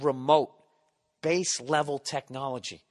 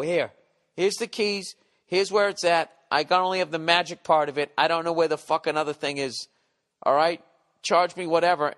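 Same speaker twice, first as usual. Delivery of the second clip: louder, fed-up mock defiance; loud pretend defiance; 4 semitones higher, 5 dB louder than usual. Handing keys to rental clerk, loud pretend defiance. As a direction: slap down the keys in your head.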